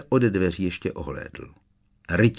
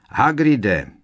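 louder call through a door; 4 semitones higher, 5 dB louder than usual. A narrator's reading. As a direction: call him out, hammering on the door. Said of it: "křikla Hermiona". (volání přes dveře, hlasitější)

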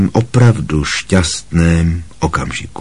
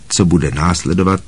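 ordinary delivery

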